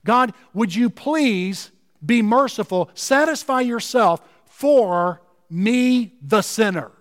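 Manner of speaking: slow plea, drawing out "satisfy yourself for me"; emphatic plea. Acted. Tax collector begging God for mercy, emphatic plea. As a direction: plead slowly, setting "the sinner" apart. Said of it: "It is emphatic here".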